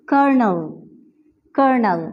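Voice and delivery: कर्नल said "ker-nel"; कर्नल with r sound, first l dropped; colonel as kernel. Shown as a demonstration. Teacher repeating colonel correctly. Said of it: "एल को जो है हमने drop कर दिया है".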